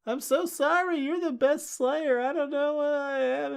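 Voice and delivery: high, tearful falsetto apology, hammed up; mock crying robot Buffy. Recapping comic, voicing Buffy's body crying in high mock sobs. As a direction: squeak it out like a sobbing imitation. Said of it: "Buffy's body starts crying".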